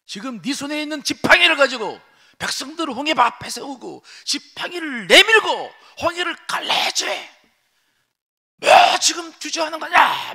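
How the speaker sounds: high, loud scolding shout; God scolding Moses. Acted. God shouting at Moses to part the sea. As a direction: shout the order to raise the staff. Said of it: "막 그러시는 거죠, 하나님이 모세에게".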